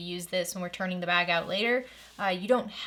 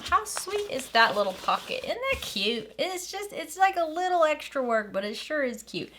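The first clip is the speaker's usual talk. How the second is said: bright, pitched-up gush over a cute result; delighted gushing; 7 semitones higher than usual. Real, not acted. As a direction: coo over how cute it turned out. Admiring the finished little pocket.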